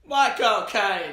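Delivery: high, drawn-out mock Jamaican yell; loud, over-the-top Jamaican attempt; yelled accent attempt. Shown as a demonstration. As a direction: bellow it slowly as a bad Jamaican impression. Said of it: "just yelled it".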